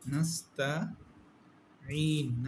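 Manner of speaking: নাস্তাইন recited slowly with a long final ন; slow recitation. Shown as a demonstration. Teacher modelling the word for students. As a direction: draw out নাস্তাইন like Quran recitation. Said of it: "তারপর হচ্ছে".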